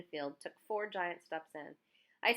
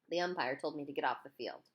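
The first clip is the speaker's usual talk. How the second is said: ordinary delivery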